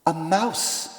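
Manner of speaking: soft hushed reply from hiding; whispered. A narrator's reading. Beowulf hidden, whispering to taunt the monster. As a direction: hush it, hidden in the shadows. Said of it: "Beowulf whispered".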